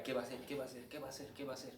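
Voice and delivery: soft repeated murmur, fading away; público expectante. Acted. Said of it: "el público espera". (público a la espera, cada vez más bajo)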